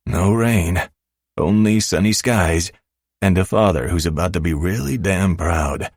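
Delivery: usual speaking voice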